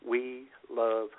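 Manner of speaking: normal talking voice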